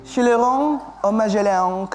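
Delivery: slow, mock French pronunciation; French accent. Shown as a demonstration. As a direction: say it like a French waiter. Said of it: "disebutkan dengan logat bahasa Prancis".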